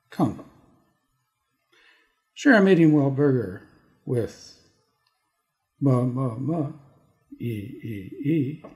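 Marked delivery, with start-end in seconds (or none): none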